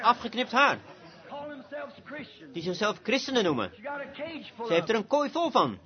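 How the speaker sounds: usual speaking voice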